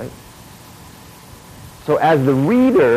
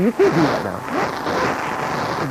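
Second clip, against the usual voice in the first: slow stern correction; 5 semitones higher, much slower than usual. Retold or imitated. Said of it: "And I say".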